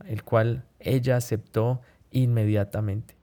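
ordinary talk, nothing marked

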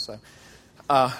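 ordinary talk

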